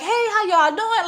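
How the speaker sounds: high, bubbly hello to everyone; extra-friendly party hello; overly friendly greeting. Retold or imitated. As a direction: greet strangers like old friends. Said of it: "I'm the type of person like".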